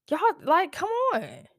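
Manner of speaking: high-pitched disbelieving plea; exasperated pleading. Real, not acted. To road-rage dads who shot their daughters, high exasperated plea. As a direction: throw your hands up, voice shooting high.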